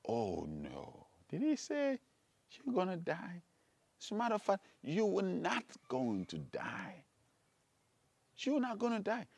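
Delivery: soft, coaxing tempter; hushed, mildly incredulous denial; devil's reassurance. Acted. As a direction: play the devil gently dismissing a worry. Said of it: "The devil came and said".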